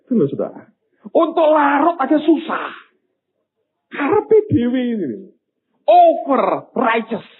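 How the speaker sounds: exasperated, high-pitched sermon voice; forceful preaching. Real, not acted. Preacher rebuking at full force.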